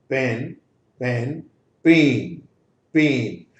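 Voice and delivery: पैन with open ऐ, then पेन with long ए; vowel contrast demo. Shown as a demonstration. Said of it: "ये different होगा". (पैन खुले ऐ से, पेन लंबे ए से)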